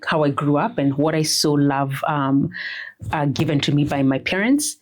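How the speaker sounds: normal talking voice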